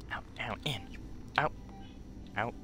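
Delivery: low, soft list of steps; hushed plan rehearsal. Real, not acted. Player sneaking through escape moves, hushed. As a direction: murmur the steps to yourself.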